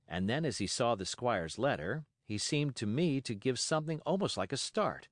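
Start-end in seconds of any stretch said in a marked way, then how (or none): none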